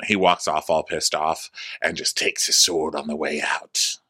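ordinary talk

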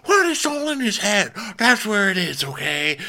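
mocking Shep dismissing Craig, high; dismissive Shep. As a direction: wave it away. Mocking Shep's reply.

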